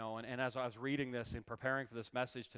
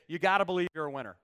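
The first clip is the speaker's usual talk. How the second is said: loud, quick, raised-voice rallying line; rousing declaration; 4 semitones higher, 11 dB louder, faster than usual. Shown as a demonstration. Preacher giving neighbors a line to say, loud and rousing. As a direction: fire up the congregation with it.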